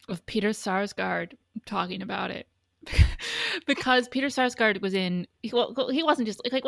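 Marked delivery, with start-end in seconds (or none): 2.9-3.6 short, high burst of laughter. Real, not acted. Host explaining the talk show clip, high laugh.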